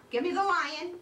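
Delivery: ordinary talk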